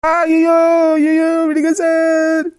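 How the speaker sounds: high, loud, drawn-out cry for mercy; pained wail. Acted. Beaten man begs to be let go, pained wail. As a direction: howl and stretch every word.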